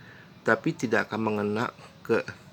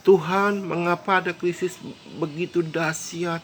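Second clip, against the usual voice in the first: anguished outcry; 7 semitones higher than usual. Retold or imitated. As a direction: cry out to heaven in despair. People crying out to God.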